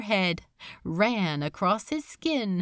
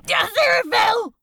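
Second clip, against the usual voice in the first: high, loud desperate scream of the name; 14 semitones higher, 6 dB louder, faster than usual. Acted. Crowley blurting the name to his torturer, screaming. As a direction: scream it out in terror. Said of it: "he screamed".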